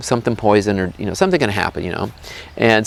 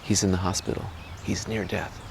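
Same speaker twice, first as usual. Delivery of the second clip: much softer narration; hushed aside; 3 semitones lower, 13 dB quieter than usual. A narrator's reading. Storyteller reveals the boy is dying, hushed aside. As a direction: drop to a grave hush. Solemn aside before deathbed verse.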